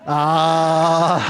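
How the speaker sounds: real caught-you laugh after a drawn-out ah; laughing groan. Real, not acted. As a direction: draw out an "aah", then laugh. Catches listener who wrote numbers down.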